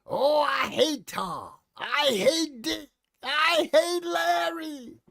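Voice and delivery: high, very slow cartoon villain whine; Oil Can Harry. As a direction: stretch each word like an old cartoon baddie. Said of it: "Oil Can Harry, remember him?".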